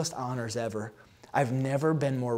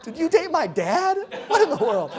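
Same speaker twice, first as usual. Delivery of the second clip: laughing disbelief; 13 semitones higher, 7 dB louder than usual. Retold or imitated. Retelling a startled, laughing question.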